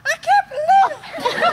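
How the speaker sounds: very high, drawn-out wail of disbelief; hammed-up, stretched falsetto exasperation; passionate frustration. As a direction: squeal it slowly with overblown passion. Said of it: "this passion in her voice".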